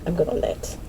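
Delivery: breathy whisper of the same line; whispered aside. Real, not acted. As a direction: breathe it out, almost to yourself. Repeats it as a whisper.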